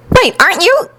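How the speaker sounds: high-pitched, surprised question; startled recognition. A narrator's reading. Woman recognizing someone near the flames, startled. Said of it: "The woman started, recognition shining".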